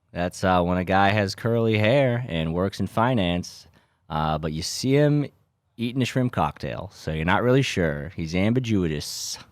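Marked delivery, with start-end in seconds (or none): none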